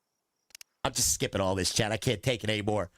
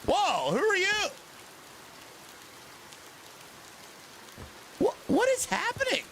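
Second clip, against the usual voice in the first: baffled by what's on screen; startled confusion; 14 semitones higher, 5 dB quieter than usual. Real, not acted. Streamer baffled by the game, startled.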